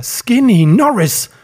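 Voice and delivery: loud, drawn-out shout; indignant shout of recognition; calling out. A narrator's reading. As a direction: cry the name high and slow. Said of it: "rufen konnte".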